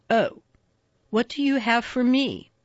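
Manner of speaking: usual speaking voice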